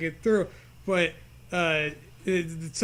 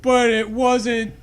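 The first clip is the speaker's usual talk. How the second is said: louder, higher teasing sing-song; mock storyteller; 4 semitones higher, 6 dB louder than usual. Friend teasing the story's twist in a spooky voice. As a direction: tease it like a spooky narrator.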